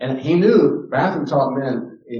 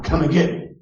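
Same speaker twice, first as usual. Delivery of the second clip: put-on child's taunt; 6 semitones higher, 5 dB louder than usual. Acting out runaway child's taunt.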